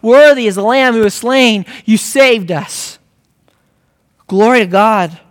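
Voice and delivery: loud, rising exultant praise; fervent sermon climax; preaching at full force. Real, not acted. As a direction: proclaim it to God with full voice. Preaches at full force, addressing God.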